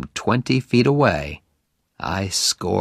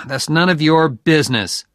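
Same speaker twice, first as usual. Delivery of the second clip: louder, higher defensive reply; quick retort; 6 semitones higher, 5 dB louder than usual. A narrator's reading. Oliver answers Ray about Cavalieri, retorting quickly. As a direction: cut the question off, raising the voice. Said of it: "I replied quickly".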